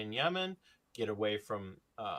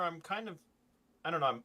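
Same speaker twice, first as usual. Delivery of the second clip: normal talking voice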